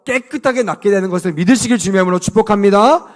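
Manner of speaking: normal talking voice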